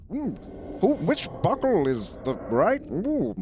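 talking to yourself while struggling with gear; muttering to himself. Acted. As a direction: fuss to yourself over the task. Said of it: "sort of talking to himself".

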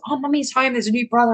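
put-on high, bright child's voice; imitating her excited son; excited little boy. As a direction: mimic an eager toddler meeting his new baby brother. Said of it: "Riley is so excited".

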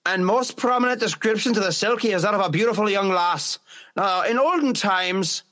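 Irish-accented storytelling, pitched a touch up. Shown as a demonstration. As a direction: read it out in an Irish lilt. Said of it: "I'll read it Irish".

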